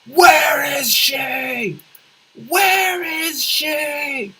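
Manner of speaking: loud, drawn-out Batman-style demand; stretched-out interrogation yell. Acted. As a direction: bellow each word slowly and high. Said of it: "and then just yell".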